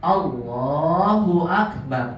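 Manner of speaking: brief first vowel, long "laa" in "Allahu"; slow "Allahu" stretched on the lam; short A, long lam. Shown as a demonstration. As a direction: hold only the second syllable of "Allahu". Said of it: "lamnya yang panjang".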